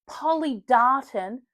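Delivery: loud drawn-out "oh" of discovery; mock realisation. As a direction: act out a sudden bright idea. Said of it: "And I thought".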